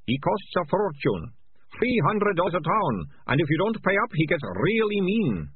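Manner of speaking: high, slowish gleeful thug; gloating thug. A narrator's reading. Ratcatcher Two gloating about the piper, high voice. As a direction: savour the threat.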